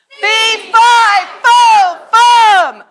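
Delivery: huge high booming shout, word by word; giant shout. Acted. Bigelow finally shouting his giant words, full-voice yell. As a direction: bellow it with the whole room. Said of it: "when Bigelow finally decided to say fee, fi, fo, fum so loudly".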